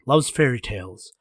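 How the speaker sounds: usual speaking voice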